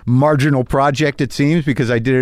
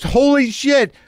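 high, drawn-out starstruck exclamation; all excited; 13 semitones higher, slower than usual. Retold or imitated. Movie star spotting the host at a benefit, all excited. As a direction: exclaim it high, thrilled to spot an idol. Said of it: "he's just all excited".

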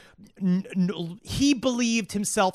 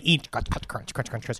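low, quiet crunching noises; soft mouth-noise crunches; imitated munching; 8 semitones lower, 7 dB quieter than usual. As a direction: mimic someone munching peanuts under your breath. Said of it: "you hear him, like".

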